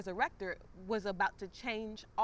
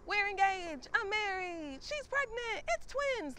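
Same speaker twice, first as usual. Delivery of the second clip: far higher, louder gushing; mock the endless happy news posts; rising giddy squeal with each piece of news; squealing announcements; 11 semitones higher, 3 dB louder, slower than usual. Mocking friends' happy announcements.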